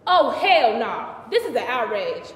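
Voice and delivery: loud theatrical indignation; outraged. Acted. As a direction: protest it emphatically, pitch leaping on the questions. Outraged at rumour about David.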